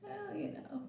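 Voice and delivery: tiny, high whispered excuse; sheepish whisper. Retold or imitated. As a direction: whisper it as a coy non-apology. Sheepish reply to angry boyfriend.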